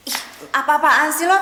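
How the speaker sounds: annoyed outburst. Acted. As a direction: push him away with scorn. Girl rejects ex-boyfriend.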